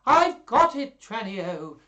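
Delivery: very high, loud burst of inspiration; hammed-up eureka shout; excited cry. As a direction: cry out the bright idea. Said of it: "cried Lucentio".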